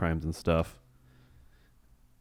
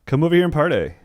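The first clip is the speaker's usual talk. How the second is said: higher, louder cheerful call across the trenches; mock party invite; 8 semitones higher, 6 dB louder, faster than usual. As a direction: call them over like it is a party. Soldiers inviting the enemy over.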